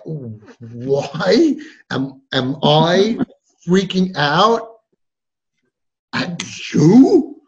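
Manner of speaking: slow, halting mock meltdown; hammed-up struggle to speak; sputtering disbelief. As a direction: stretch each word, straining to get it out. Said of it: "we become like Tourette's".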